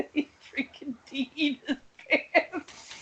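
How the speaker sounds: high laughter, slow words; real laughter; laughing. Real, not acted. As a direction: laugh it out in disbelief. Laughing at friend's blunder.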